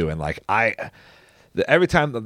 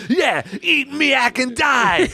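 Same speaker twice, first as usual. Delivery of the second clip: high, drawn-out mock battle cry; Ernest; 13 semitones higher, slower than usual. Ernest attacks the troll with miak, high mock battle cry. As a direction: shout a hammed-up heroic threat, slow and high. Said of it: "And he goes".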